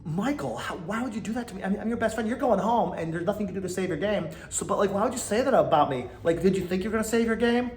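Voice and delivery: high, mock whiny pleading; mocking whiny houseguest. Podcaster mocking Brittany pleading with Michael. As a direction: whimper it like a betrayed crybaby. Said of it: "you're acting like".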